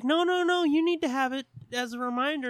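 high, loud, rushed mock protest; insistent refusal. Her refusing Watson's rock, mock insistent. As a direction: push the gift back urgently.

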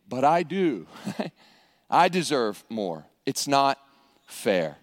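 low, deliberate mock-sullen complaint; envious grievance. Voicing envious believer's implied complaint.

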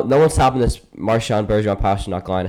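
ordinary talk, nothing marked

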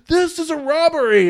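very high, strained holdup cry; hammed-up robber calling out to a crowd; mock robbery announcement. Acting out restaurant stickup.

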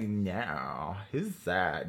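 low, quiet, distant voice down the line; friend on the phone. Retold or imitated. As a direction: sound small and far off, like a voice on the other end of a call. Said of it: "hear her friend being like".

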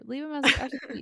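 voicemail greeting read-out; answering machine voice. Pretend. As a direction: sound like a recorded message. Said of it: "let's pretend it just started".

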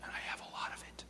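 sly whispered confession; whispered aside. Real, not acted. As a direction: whisper it like a guilty secret.

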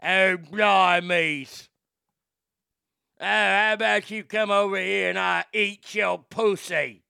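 loud, hammed-up British accent; hardened British inmate. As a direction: put on a brash British convict's accent. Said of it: "some hardened British gals in prison".

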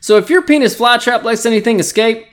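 usual speaking voice

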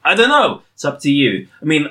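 ordinary talk

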